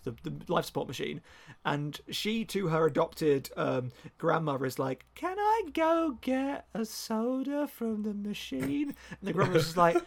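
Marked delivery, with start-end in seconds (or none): 5.2-8.9 put-on high, slow, singsong child voice; pleading little child. Orphan asking her grandmother for a soda, high little-child voice.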